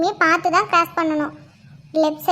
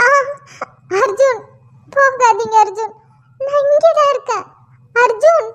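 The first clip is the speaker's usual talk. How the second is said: desperate calling; 6 semitones higher, 4 dB louder than usual. Acted. Calling husband who walks away.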